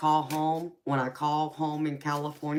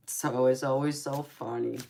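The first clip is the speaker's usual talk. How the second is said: ordinary talk, nothing marked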